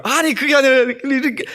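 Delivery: a child protesting to his mother, high-voiced; talking back. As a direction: kid arguing back at mom in a high voice. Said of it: "막 대들어요".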